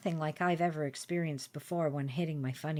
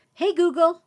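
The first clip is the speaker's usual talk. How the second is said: loud, high-pitched call to Google; 11 semitones higher, 10 dB louder than usual. Retold or imitated. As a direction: call out to wake the voice assistant. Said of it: "I called".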